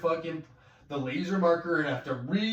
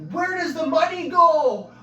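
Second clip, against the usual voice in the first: high, loud demanding cry; exasperated yell; 11 semitones higher, 9 dB louder than usual. Retold or imitated. Asking the boss where the EI money goes, a high loud exasperated yell. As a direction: demand it at full voice. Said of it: "I'm like".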